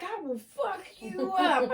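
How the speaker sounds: a hint of a tough girl's slow threat; girlfriend's threat. Girlfriend stands up and threatens the table, imitated slowly. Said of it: "she stands up and she's like".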